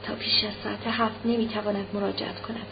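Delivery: saying to herself. A narrator's reading. Anna alone crumpling the telegram, quietly repeating it to herself. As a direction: drop the volume, repeat it inwardly. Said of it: "ورقهٔ تلگراف را در دست مچاله کرده و پیش خود".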